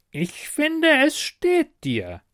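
high, slow kobold pronouncement; grandiose. Acted. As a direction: declare it pompously, drawing it out. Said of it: "erklärte großspurig". (großspurig, gedehnt)

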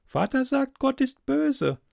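put-on high, girlish voice; captive girl, matter-of-fact. Girl offers stranger coffee through the wall, high and matter-of-fact. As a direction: speak as an unbothered young girl, pitched high.

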